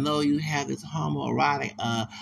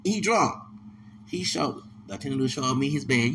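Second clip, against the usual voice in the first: ordinary talk, nothing marked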